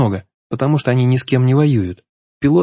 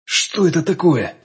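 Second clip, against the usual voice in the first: startled exclamation; 6 semitones higher, slower than usual. A narrator's reading. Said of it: "не удержался от возгласа".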